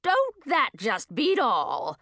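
put-on old witch gloating over the news, slow cackle; cackling old witch. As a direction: savour it like a cackling hag. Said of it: "cackled Madine".

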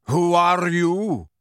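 stern centaur voice, words stretched; rough centaur challenge. A narrator's reading. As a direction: challenge the intruders slowly and forcefully. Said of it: "said Magorian roughly".

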